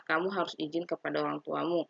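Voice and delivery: ordinary delivery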